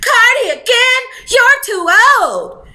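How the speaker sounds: high, loud exasperated mother; scolding mom. Acted. Mom reacting to another pregnancy, high exasperated scolding. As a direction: scold your grown daughter, stretching the last words. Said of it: "I could hear her now".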